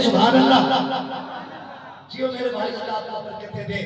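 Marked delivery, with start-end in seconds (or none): 0.0-0.9 cheerful cue. Shown as a demonstration. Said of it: "ذرا committee والے بولیں گے".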